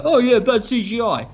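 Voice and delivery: high, smug mock critic voice. Director mimicking viewers who dismiss the CGI, smug and high. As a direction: sneer it like a know-it-all viewer. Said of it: "and you go".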